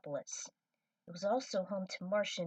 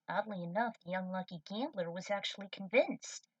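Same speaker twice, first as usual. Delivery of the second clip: plain reading voice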